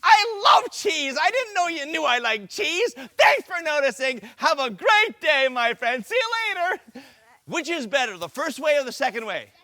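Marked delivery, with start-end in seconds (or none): 0.0-7.0 kill the insult with delight; cheerful over-the-top agreement. Shown as a demonstration. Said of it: "Or he comes up to me and says".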